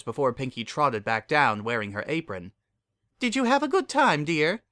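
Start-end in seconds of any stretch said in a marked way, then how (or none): 3.2-4.6 motherly baker. Acted. Mrs Cake voiced in story.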